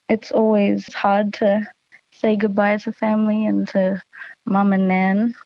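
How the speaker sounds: ordinary talk, nothing marked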